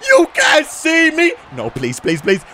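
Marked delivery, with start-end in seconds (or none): 0.1-1.3 hammed-up wrestler taunt, stretched out; John Cena catchphrase. Gamer mimicking a wrestler's taunt, high shout. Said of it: "he's doing the".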